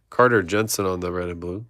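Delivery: usual speaking voice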